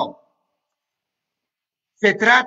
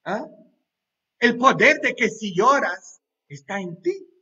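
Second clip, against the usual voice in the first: usual speaking voice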